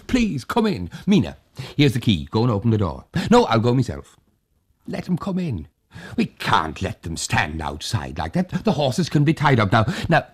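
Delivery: put-on high, fussing, hospitable old man; eager host. Old Tag urging the hussar to drink, eager coaxing. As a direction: coax and press him eagerly.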